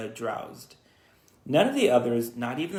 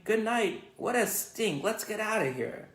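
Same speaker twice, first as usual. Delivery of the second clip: put-on disgusted boy; 8 semitones higher than usual. Boy fleeing the smell.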